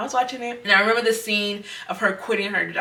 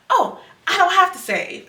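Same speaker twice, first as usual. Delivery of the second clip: carefree mock; 11 semitones higher than usual. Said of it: "my mindset then was".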